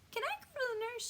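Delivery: very high whiny schoolkid; small high-pitched whine; whining. A narrator's reading. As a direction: plead in a high little whine. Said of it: "she whines".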